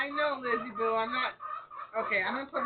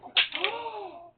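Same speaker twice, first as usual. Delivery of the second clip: gasp; 10 semitones higher, 6 dB louder than usual. Real, not acted. Sudden gasp at bird.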